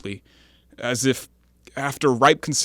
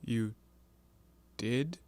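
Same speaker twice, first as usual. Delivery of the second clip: much softer, stunned disbelief; hushed shock; 3 semitones lower, 9 dB quieter than usual. Acted. Man hearing a confessed killing, hushed and stunned. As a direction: barely voice the question in shock.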